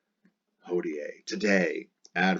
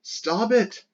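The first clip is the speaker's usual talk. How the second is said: mock plea; 13 semitones higher than usual. Cry at fleeing Trojan.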